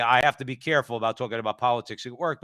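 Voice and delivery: ordinary talk, nothing marked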